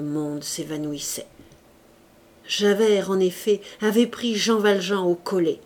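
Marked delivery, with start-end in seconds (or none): none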